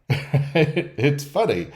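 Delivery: drawn out slowly through a laugh; laughing mock quote. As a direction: stretch it out, chuckling as you go. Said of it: "they like to start with".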